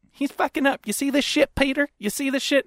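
high-pitched indignant imitation; mocking the woman. Woman complains to husband about stranger, high mock indignation. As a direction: mimic her griping to her husband, pitched high.

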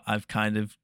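normal talking voice

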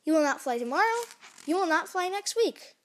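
insistent. Acted. Gerald insists against Piggy's plan.

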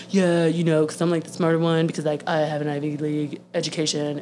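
hurried, low-pitched mocking imitation; mocking smug politician. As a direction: imitate a self-satisfied know-it-all, dropped low. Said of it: "you're talking about".

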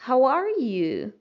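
"How are you" said slowly and joined up; model question. Shown as a demonstration. Teacher joins the words for her students. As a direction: join "How are you" into one slow phrase. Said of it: "Juntando tudo".

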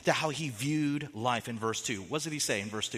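ordinary delivery

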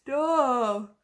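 a yawn swallowing the word. Real, not acted. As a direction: stop stretched by a yawn. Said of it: "There we go".

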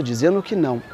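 usual speaking voice

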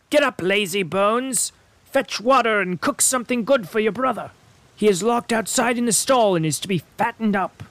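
high, scolding witch voice; harsh witch. A narrator's reading. Witch waking Gretel, high and scolding. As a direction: bark orders at a lazy servant. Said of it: "she shook Gretel and cried".

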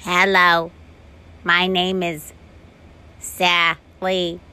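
flat robot introduction. Acted. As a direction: speak like a toy robot. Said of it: "funny robot voice".

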